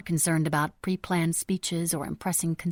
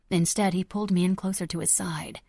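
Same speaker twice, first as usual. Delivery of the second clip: ordinary delivery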